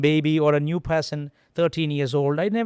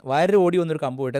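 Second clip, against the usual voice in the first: usual speaking voice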